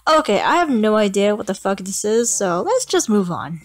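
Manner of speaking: normal talking voice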